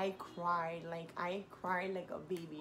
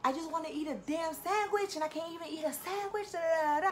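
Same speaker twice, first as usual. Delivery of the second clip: much higher, louder whining rant trailing into da da da; whiny tantrum; 9 semitones higher, 3 dB louder than usual. Retold or imitated. Whining to her sisters she can't eat, hammed-up higher tantrum. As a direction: throw a mock childish fit over the sandwich. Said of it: "My baby sister gets annoyed when I do that".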